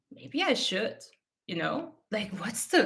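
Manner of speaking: softly, as if thinking aloud to herself; quiet musing. Retold or imitated. Quiet thought after mom's question.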